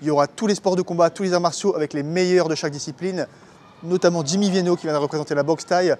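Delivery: upbeat event ad read; promo read. Acted. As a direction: sell the festival to viewers.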